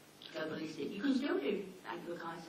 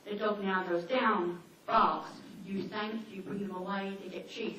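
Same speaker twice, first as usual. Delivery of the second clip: usual speaking voice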